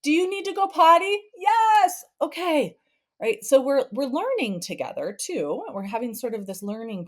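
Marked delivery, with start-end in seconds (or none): 0.0-2.8 higher and louder quick dialogue; higher mom and kid exchange. Retold or imitated. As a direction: act both voices briskly.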